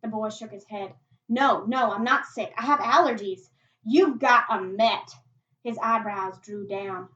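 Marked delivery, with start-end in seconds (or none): 1.3-3.5 loud, insistent protest; emphatic denial. Acted. Boy denies being sick at gunpoint. 3.8-5.1 loud, drawn-out charge; loud accusation. Acted. Martha accuses the boy.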